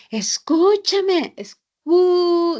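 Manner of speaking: reproachful "Escúchame" with a long, high, held "ú"; drawn-out U. Acted. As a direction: stretch the "ú" of "Escúchame" as if demanding attention. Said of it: "a veces alargamos la U".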